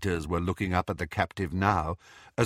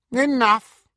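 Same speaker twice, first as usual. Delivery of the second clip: high, louder cold command; sharp command; 9 dB louder than usual. Acted. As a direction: cut the laughter off sharply. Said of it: "stroking the angry snake".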